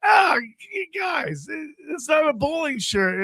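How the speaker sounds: high-pitched mock exasperation; playfully aggrieved, high and insistent; exasperated protest.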